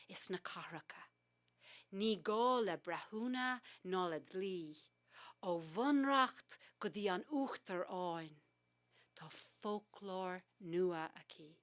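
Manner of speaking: measured Irish recital, drifting between whisper and full voice; poem recitation. Acted. Poet reads her Irish poem aloud to the host, hushed recital. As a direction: let each line land like a spell.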